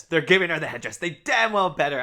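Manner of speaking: loud, thrilled insistence; almost shouting with excitement; excited. Real, not acted. Excited about headdress.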